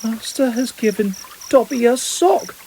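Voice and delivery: high, squeaky house-elf voice in awe; Dobby in wonderment. A narrator's reading. Elf marvelling at the sock to his master. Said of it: "said the elf in wonderment".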